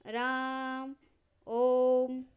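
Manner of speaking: राम and ओम held long as if calling from afar; calling out ओम with a long held vowel; prolonged pluta call. Shown as a demonstration. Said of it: "इसका प्रयोग अक्सर पुकारते समय किया".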